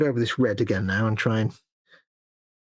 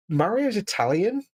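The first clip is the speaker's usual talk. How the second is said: incredulous; 7 semitones higher, slower than usual. Real, not acted. Incredulous reaction to claim.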